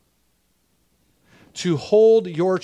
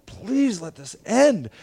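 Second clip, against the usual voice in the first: slow, higher-pitched retold begging; anguished plea; 4 semitones higher, slower than usual. Father holding screaming son wishes it over, pleading. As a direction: draw it out as a desperate, contained plea.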